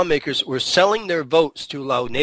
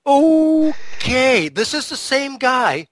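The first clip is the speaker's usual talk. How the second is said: incredulous; 9 semitones higher, slower than usual. Real, not acted. Incredulous about the crack scandal.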